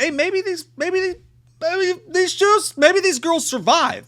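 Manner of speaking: high, halting, squeaky hope; slow, hesitant falsetto wishing; naive hopefulness. Retold or imitated. Viewer daring to hope the girls survive, naively hopeful.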